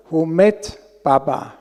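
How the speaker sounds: ordinary talk, nothing marked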